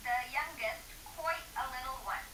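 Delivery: soft hushed storytelling voice; whispered reading. A narrator's reading. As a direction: read it in a near whisper.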